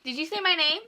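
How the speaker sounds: high, loud alarmed question; startled high question. Real, not acted. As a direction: ask in sudden fright. Startled on hearing her name.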